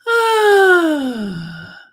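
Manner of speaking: high, loud open sigh of release; big loud sigh. Shown as a demonstration. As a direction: let it all out in one big sigh. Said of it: "loud sigh like that, too".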